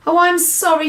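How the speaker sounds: put-on a small girl saying sorry; little girl voice. Sophie apologising.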